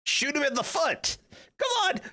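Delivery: high-pitched, hammed-up indignation; squealed mock fury; mock outrage. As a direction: yelp it in playful outrage at an enemy. Player lashes out at the alien.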